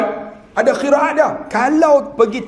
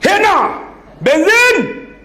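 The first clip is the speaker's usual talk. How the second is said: excited pointing cry; 9 semitones higher, much slower than usual. Acted. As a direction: point and shout at the tank.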